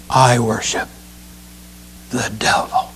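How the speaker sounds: low, hushed spooky whisper; mock a creepy horror butler; a low, eerie whisper fading to nothing; Lurch and Vincent Price. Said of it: "kind of a cross between Lurch and Vincent Price".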